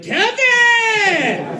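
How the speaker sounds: long, high holler across a store; imitated yell. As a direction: mimic a drunk fan yelling a name, drawn out. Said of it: "inappropriate times to yell at me".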